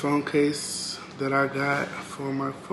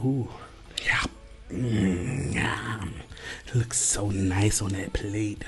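slow hushed whisper; slow whisper while admiring a plate of food; slow savoring whisper; 5 semitones lower, 7 dB quieter, much slower than usual. Real, not acted. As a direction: breathe it out slowly, admiring the plate.

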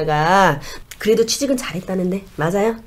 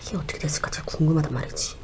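hushed whisper; 9 dB quieter, faster than usual. Acted. Shaman muses over dead friend.

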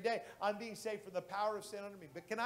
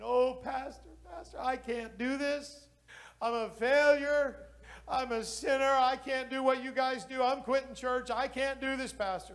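imitating a crying man; 5 semitones higher, 5 dB louder than usual. Pastor voices weeping convert Alan.

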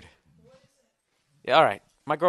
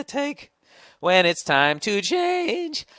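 slow dramatic drawl; 8 semitones higher, 5 dB louder, much slower than usual. Acted. Mock soothing slow line.

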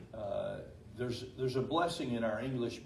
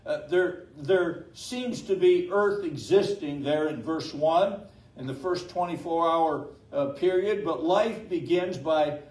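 preaching at full force; 4 semitones higher, 10 dB louder than usual. Acted. Pastor preaching to the congregation on God's breath, fervent. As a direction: build the sermon up, pressing each phrase home.

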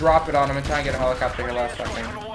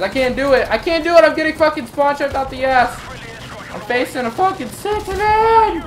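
exasperated shout; 9 semitones higher, 5 dB louder than usual. Real, not acted. Gamer getting spawn trapped by the other team, exasperated shout. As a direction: wail high and loud in frustration.

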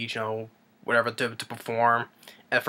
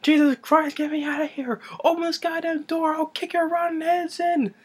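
very high mock-hysterical yelling; freaked-out panic. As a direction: imitate frantic demands climbing to a wail. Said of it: "he gets freaked out".

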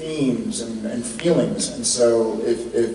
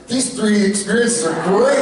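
mock moviegoer; 9 semitones higher, 4 dB louder than usual. Imagined moviegoer quote.

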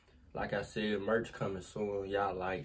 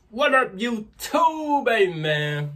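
hyped greeting; 12 semitones higher, 9 dB louder, much slower than usual. Real, not acted. Outro greeting.

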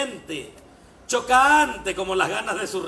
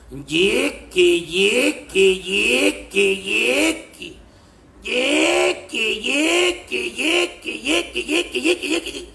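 rhythmic panting grunts; 5 dB louder, much slower than usual. Acted.

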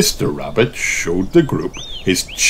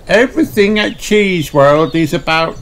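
Miss Rabbit voice; 9 semitones higher than usual. Acted. Miss Rabbit welcomes the party, cheerful guide voice. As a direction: greet them like a bubbly guide.

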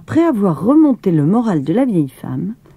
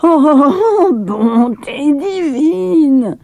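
put-on shocked wail at a blue child; old woman crying out; 10 semitones higher, much slower than usual. A narrator's reading. Maya finds Ida blue in bed, a high drawn-out cry. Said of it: "elle se mit à crier".

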